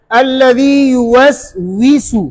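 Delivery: slow, careful with lips rounded on युवस्विसु; drawn-out recitation demo; lip-rounded waw. Shown as a demonstration. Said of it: "हर हालत में होंठ गोल करें".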